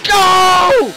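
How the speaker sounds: high, loud shouts of go; urgent cheering on. Real, not acted. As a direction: yell the dummy forward. Urging ninja dummy into traffic.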